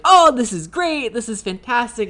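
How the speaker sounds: imitating an overjoyed historian; energetic and happy. To the guys at dinner, imitating a gushing historian. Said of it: "he's always so, like, energetic and so happy".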